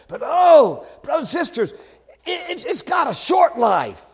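high-pitched pulpit cry; impassioned preaching. Real, not acted. Preacher exhorting brothers and sisters, impassioned preaching. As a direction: cry it out to the congregation.

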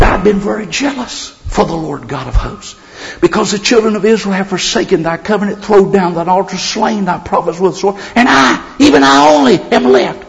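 whining complaint. Acted. Elijah repeating his spiel to God, whining loudly. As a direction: build to a high, loud whine. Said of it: "say verse fourteen without whining".

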